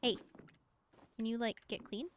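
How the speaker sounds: ordinary talk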